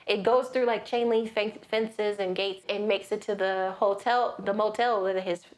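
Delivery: normal talking voice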